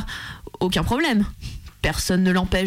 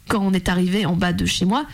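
ordinary talk, nothing marked

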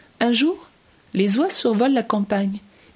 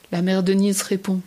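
ordinary delivery, nothing marked

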